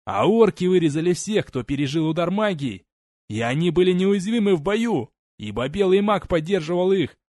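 desperate shout. Acted. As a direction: scream the truth from the gallows. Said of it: "он закричал".

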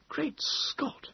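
hushed, high breathy whisper. A narrator's reading. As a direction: whisper it to your companion. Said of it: "Moses whispered to me".

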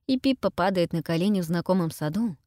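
ordinary delivery

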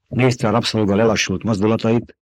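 usual speaking voice

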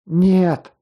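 high, pained exclamation; pained refusal. A narrator's reading. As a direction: cry out in hurt refusal to accept it. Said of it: "боль в голосе говорила о нежелании мириться".

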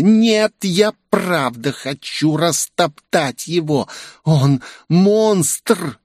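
high, slow whining tantrum; whiny tattling little sibling. A narrator's reading. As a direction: whimper like a sulking kid. Оливия продолжает хныкать. (хнычущий каприз, высоко и медленно)

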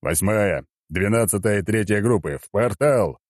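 understated military bark; barked order. A narrator's reading. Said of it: "гаркнул Черномор".

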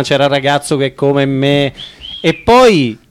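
ordinary talk

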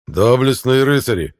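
loud, high call over the hall; booming warrior king. A narrator's reading. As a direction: boom across the hall with suppressed rage. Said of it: "Голос короля, пронёсшийся по залу". (громогласный король, сдерживающий ярость)

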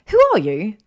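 loud, high startled question; startled demand. Acted. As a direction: blurt it out at an intruder. Startled by a ghost intruder.

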